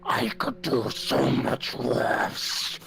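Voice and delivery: slow, distorted monster voice; drawn-out menacing monster growl. Acted. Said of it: "my more fucked up voice".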